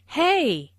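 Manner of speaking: demonstrated exclamation of surprise; surprised. Shown as a demonstration. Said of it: "used to show surprise".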